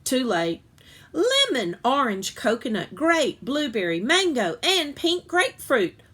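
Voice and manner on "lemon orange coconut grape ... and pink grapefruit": bright rapid-fire list, higher on the first and last items; eager listing. A narrator's reading. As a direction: sell every flavor with gusto. Eager salesman lists colors.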